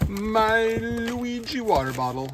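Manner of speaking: hammed-up, badly faked Italian accent; cartoonish bad Italian accent; terrible Italian accent. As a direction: stretch it out in a mock Italian accent. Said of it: "with my terrible Italian accent".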